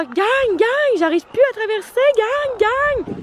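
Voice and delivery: high squeaky voicing of a hen; chicken voice. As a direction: speak for the hen. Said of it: "Il est genre".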